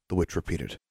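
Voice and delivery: usual speaking voice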